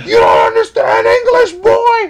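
high, loud imitation of a furious customer; yelling. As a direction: holler it in outrage as the customer. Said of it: "He's yelling".

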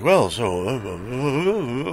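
imitating a low, lulling interviewer. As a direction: drop low and draw out the hesitations. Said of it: "Stern just lowers his voice".

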